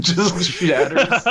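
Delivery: can barely get it out for laughing. Real, not acted. Friends cracking up over the goldfish, laughing words.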